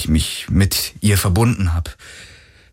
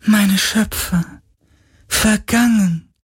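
high, slow wail of grief; lamenting ghost; 14 semitones higher, slower than usual. Acted. Ghost laments its dead makers to Galen, high slow wail. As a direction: mourn the lost makers. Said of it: "klagte der Geist".

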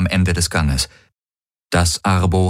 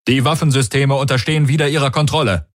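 high, quick report; technician's voice; 7 semitones higher, faster than usual. A narrator's reading. Technician reporting restored weapons to his commander, high and quick. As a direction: rattle off a status report eagerly. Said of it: "die Stimme des Technikers".

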